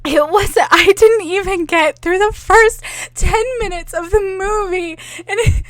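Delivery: ordinary talk, nothing marked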